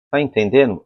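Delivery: ordinary talk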